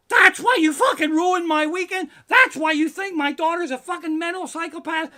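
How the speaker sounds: screaming rant, very loud and high; furious shouting. Retold or imitated. Dad blasting the fourth grade teacher, screaming fury. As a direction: explode at the teacher with full fury.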